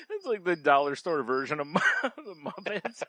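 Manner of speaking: words breaking up into real laughter; high, giggle-choked delivery; laughing hard. Real, not acted. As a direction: barely get the punchline out for laughing. Cracking up at the misspelling.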